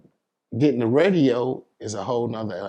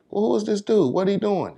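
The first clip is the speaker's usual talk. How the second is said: much higher, a mock-baffled "who is this?"; puzzled crowd; 10 semitones higher than usual. Rapper retells how the public reacted, in a high mock-baffled voice. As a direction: act out the whole town scratching its head.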